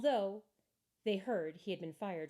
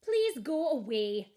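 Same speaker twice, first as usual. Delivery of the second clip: louder, higher, slow dismissive please; curt owner; 6 semitones higher, 10 dB louder, slower than usual. Acted. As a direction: drag it out to send him away. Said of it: "said the restaurant owner".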